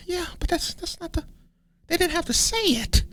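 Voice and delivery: very high, small mock whine; defensive whine. Narcissist answers when asked if he is one, defensive whine. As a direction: sputter a squeaky, wounded excuse. Whiny defensive reply, acted.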